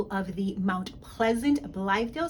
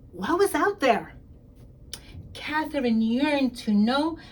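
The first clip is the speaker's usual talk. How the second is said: put-on lively storybook reading for young kids; expressive picture-book read-aloud; reading to children; 4 semitones higher, 5 dB louder than usual. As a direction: read it out like story time in class. Principal reads picture book aloud.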